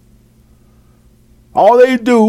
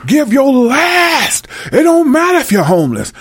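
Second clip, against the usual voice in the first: mock Baptist preacher; 8 semitones higher than usual. Baptist preacher urging a struggling congregation to give their last, mock preaching. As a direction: stretch it like a parody preacher, then rush on. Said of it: "Baptist preachers are the worst".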